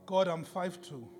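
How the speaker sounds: usual speaking voice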